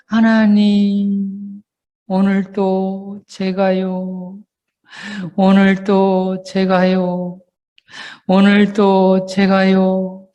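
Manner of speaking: very slow, halting plea to God; slow, aching confession; tearful repentant prayer. Shown as a demonstration. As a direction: drag each word out like a penitent close to tears.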